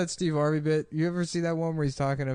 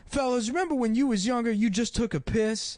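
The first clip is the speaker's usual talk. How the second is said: Steve Harvey impression; 8 semitones higher than usual. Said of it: "he has one special where he's talking about".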